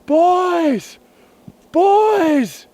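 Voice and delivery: desperate shout from under the snow; yelled. Retold or imitated. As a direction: yell up the slope for the boys. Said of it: "So I'm yelling".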